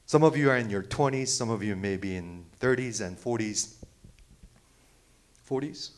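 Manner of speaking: ordinary delivery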